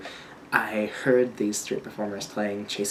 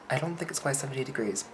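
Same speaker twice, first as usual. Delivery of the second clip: ordinary talk, nothing marked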